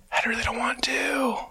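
breathy, high whispered whine; whiny whisper. Retold or imitated. Husband whispering his refusal to his wife.

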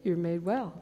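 a tender Jesus voice; gentle Jesus. Retold or imitated. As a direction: tell him kindly he's healed. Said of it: "Jesus says".

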